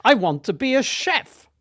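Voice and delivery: lightly voiced eager schoolboy; younger self. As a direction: play your fifteen-year-old self answering sir. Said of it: "I said".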